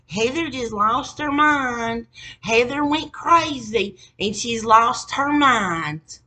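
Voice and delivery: drawn-out mock tattling; mocking family gossip. Relatives gossiping about Heather, slow mocking voice.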